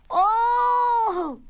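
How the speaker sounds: long, high, loud cry of wonder; thrilled awe. Acted. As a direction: hold it high like an amazed crowd. Children thrilled by the news.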